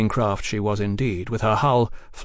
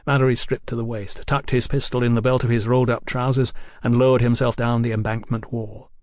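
plain reading voice

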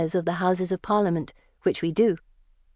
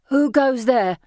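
higher, louder, calling out into fog; shouting; 6 semitones higher, 5 dB louder, slower than usual. A narrator's reading. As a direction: shout a challenge into the dark. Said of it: "the man shouts".

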